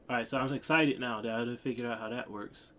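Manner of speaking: ordinary talk, nothing marked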